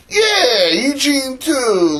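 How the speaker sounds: high, drawn-out mock wail; wailed reply. Retelling God reassuring them about Eugene, high mock wail. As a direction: draw it out high, as if whimpering.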